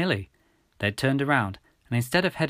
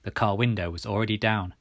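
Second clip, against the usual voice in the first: ordinary delivery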